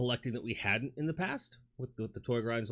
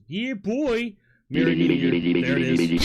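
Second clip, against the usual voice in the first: hyped shout; 6 semitones lower, 10 dB louder than usual. Retold or imitated. Streamer shouting out a super chat, loud and hyped. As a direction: yell it like a hype man. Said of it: "super chat, says".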